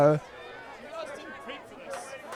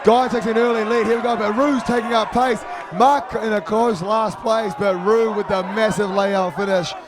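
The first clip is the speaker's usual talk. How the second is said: race caller; 6 semitones higher than usual. Acted. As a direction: shout the race home. Live race call.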